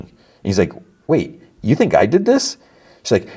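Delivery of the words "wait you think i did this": high, disbelieving question; surprised. Retold or imitated. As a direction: caught off guard, pitch jumps up. Son accused by mom.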